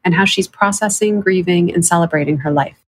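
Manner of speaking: podcast intro read. Acted. Host opening the show for listeners, bright podcast read. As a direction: welcome listeners into the episode.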